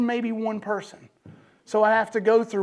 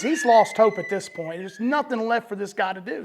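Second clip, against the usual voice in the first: ordinary talk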